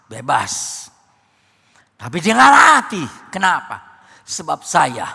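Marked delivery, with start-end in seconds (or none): 0.1-0.5 Bebas dropped very low and soft, like an afterthought; quiet echo. Acted. Preacher repeats word contrastively.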